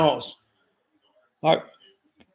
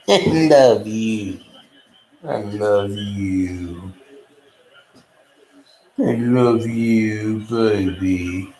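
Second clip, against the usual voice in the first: cooing to dog; 3 semitones lower, much slower than usual. Real, not acted. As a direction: stretch each word lovingly for the puppy. Cooing to the pug.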